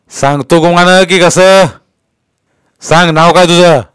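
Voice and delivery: bullying police interrogation; threatening inspector. A narrator's reading. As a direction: menace the boy into answering. Inspector threatens boy with baton.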